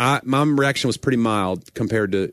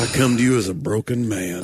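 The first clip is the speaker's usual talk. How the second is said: lightly hammed pulpit voice; a hint of a solemn preacher's confession; mock preacher; 4 semitones lower than usual. Joking about the sermon, mildly mock solemn preaching. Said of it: "make that about the sermon".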